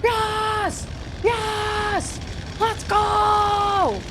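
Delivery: high, loud victory shouts; excited cheering. Real, not acted. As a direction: cheer like you just won. Celebrating a coin flip win.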